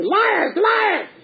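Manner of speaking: high, shouted puppet squawk; Punch screaming. Retold or imitated. Punch screaming at the children, high puppet shout. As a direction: shriek it at the kids like Punch. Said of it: "he screams at the children".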